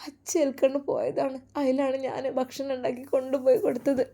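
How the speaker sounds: high, soft tearful lament; acted weeping; crying mother. A narrator's reading. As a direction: pour out a mother's helpless grief. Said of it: "നിങ്ങൾ കരയല്ലേ".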